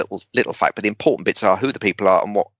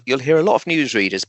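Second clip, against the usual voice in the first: normal talking voice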